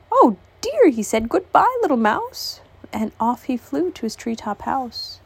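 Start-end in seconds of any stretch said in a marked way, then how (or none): 0.1-2.6 high, alarmed owl voice; scared owl. Acted. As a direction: squeak and scurry off. Owl flees in fright.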